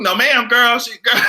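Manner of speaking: usual speaking voice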